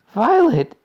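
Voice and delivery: high, loud cry of the name; scream. A narrator's reading. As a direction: shriek for your daughter. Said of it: "screamed Missus Beauregard".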